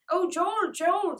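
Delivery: loud, high distressed call. Acted. Francie calling after Joel, frightened. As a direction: call after him as he leaves. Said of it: "said Francie, running after him".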